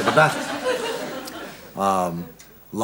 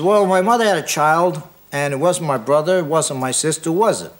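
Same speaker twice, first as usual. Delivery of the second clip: put-on brash Brooklyn accent, higher and louder; Brooklyn cab driver; 5 semitones higher, 5 dB louder than usual. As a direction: cabbie posing a riddle in Brooklyn accent. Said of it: "Cab driver's from Brooklyn".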